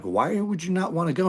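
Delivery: retold disbelief pitched well up; baffled question. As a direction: ask it like it makes no sense at all. Arguing with bass player.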